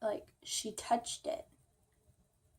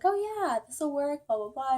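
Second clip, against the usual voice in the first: cheerful helper; 5 semitones higher than usual. Retold or imitated. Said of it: "she's like".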